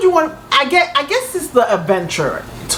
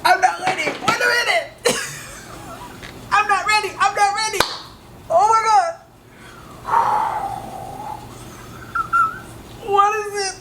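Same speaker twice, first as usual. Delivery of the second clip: high, softer nervous pleading; anxious; 12 semitones higher, 5 dB quieter than usual. Real, not acted. Reactor dreads the next part of the story, anxious. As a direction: brace yourself, dreading what comes. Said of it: "my anxiety is high for her".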